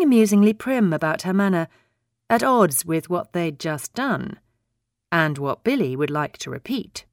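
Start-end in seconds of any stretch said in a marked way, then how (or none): none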